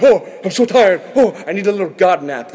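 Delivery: hammed-up worn-out sighing, pitched high; comically overdone weariness; mock exhausted. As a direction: play exaggerated tiredness, big and loud. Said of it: "when he's done, he wasn't like".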